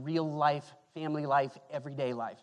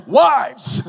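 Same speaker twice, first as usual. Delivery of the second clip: mock shouted Wives! loud and high, much louder; imitated loud shout; 8 semitones higher, 16 dB louder than usual. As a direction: belt Wives! like the overeager guy in the pews. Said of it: "the first one say wife was this guy".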